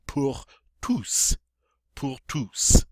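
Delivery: "pour tous" with the S pronounced, "toos"; sounded final S. Shown as a demonstration. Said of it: "we get to pronounce the S".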